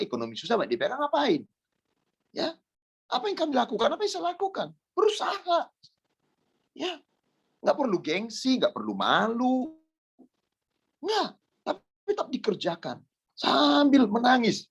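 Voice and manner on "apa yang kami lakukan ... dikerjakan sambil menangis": high-pitched exhortation; urgent preaching. Real, not acted. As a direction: urge them on in a raised preacher's pitch. Exhorts the congregation. (seruan khotbah bernada tinggi)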